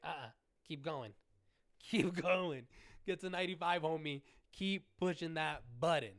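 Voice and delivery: hyped cheering. Retold or imitated. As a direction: cheer the counter along. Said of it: "I was so hype".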